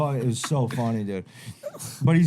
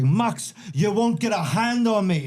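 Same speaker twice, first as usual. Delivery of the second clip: put-on high, cocky Irish accent; pitched-up Irish fighter impression; Conor McGregor; 7 semitones higher, slower than usual. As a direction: taunt in a put-on Irish brogue. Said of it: "How about McGregor coming back".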